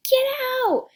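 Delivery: high, loud mock yell. Frustrated sibling when someone bursts into the room, acted yell. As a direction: yell it sharply, as an example. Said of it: "You might yell".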